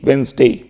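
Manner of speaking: वेडनेसडे as Wenz-day, d silent; correct pronunciation. Shown as a demonstration. Teacher repeating the right pronunciation for students. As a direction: repeat वेडनेसडे cleanly without the d. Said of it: "बुधवार का pronunciation होता है वेडनेसडे".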